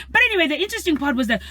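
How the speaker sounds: ordinary talk, nothing marked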